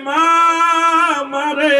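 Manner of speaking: high, drawn-out wail to mother; tearful cry. Acted. Son at his mother's grave calls to her, tearful drawn-out cry. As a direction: call out for mother in acted grief. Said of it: "চোখের পানি ছেড়ে দিয়ে কয়".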